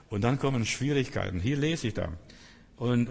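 ordinary talk

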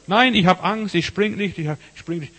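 put-on high frightened child's cry, fading at the end; panicked refusal from the balcony; scared child. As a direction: cry out like a terrified boy.

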